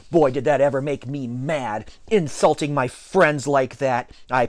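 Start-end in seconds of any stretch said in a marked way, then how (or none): none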